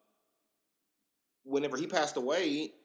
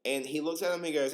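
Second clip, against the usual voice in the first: usual speaking voice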